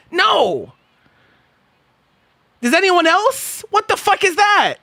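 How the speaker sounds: high-pitched bewildered protest; incredulous. Real, not acted. Streamer asking chat about a bizarre phrase, incredulous. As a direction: demand an explanation, voice way up in disbelief. Baffled at viewer's odd phrase.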